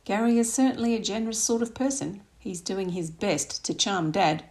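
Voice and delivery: plain reading voice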